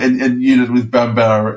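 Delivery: ordinary delivery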